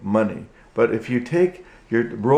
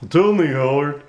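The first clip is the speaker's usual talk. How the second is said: higher, bright puppet hellos; puppet greeting; 4 semitones higher, 4 dB louder than usual. Acted. Puppet Goodman greets the viewers, higher puppet voice.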